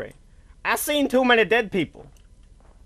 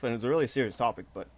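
ordinary delivery

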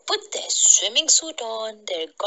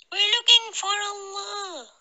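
high-pitched character voice; Ilyas; 13 semitones higher than usual. A narrator's reading. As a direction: lift the line high for the story's seeker. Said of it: "said Ilyas".

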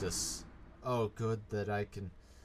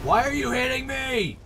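high, loud outburst; exasperated shout; 12 semitones higher, 8 dB louder than usual. Real, not acted. Player protesting to enemy that is hitting him, exasperated shout. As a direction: yell it in real outrage. Hit by enemy while fearing death.

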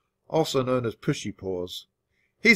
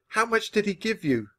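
high, squeaky panic; high squeak; 7 semitones higher than usual. A narrator's reading. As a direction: squeak the question out. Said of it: "I squeaked".

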